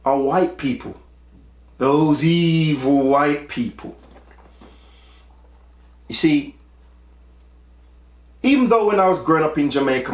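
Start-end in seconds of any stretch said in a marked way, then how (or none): 1.8-4.0 slow, mocking drawl on evil; mock the cliché with heavy irony; sarcastic. Sarcastic jab at a stereotype.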